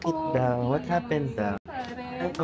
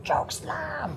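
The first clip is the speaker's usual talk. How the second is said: whispered tease; 12 semitones higher, 6 dB quieter than usual. Real, not acted. Teasing whisper to child.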